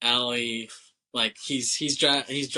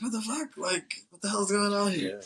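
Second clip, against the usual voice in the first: high-pitched, hushed mock disbelief; bewildered shock; 9 semitones higher than usual. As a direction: imitate a guy baffled and alarmed. Acting out shock at girlfriends together.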